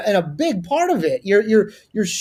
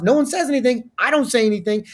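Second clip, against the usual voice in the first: ordinary talk, nothing marked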